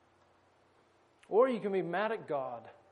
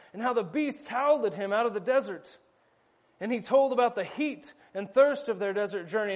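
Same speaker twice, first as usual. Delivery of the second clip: lively read-aloud narration from the book; story reading; 4 semitones higher, 4 dB louder than usual. Acted. Preacher reading Narnia to his listeners, animated storytelling. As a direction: read the passage to the congregation with energy.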